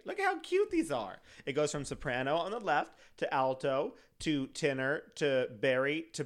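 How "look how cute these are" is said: cooing excited. Real, not acted. Cooing over saxophones.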